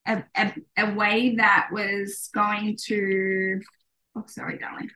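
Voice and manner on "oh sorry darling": whispered aside. Real, not acted. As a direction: whisper it to your little one. Aside to her toddler.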